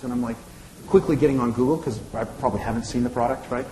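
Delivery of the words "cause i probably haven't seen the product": ordinary delivery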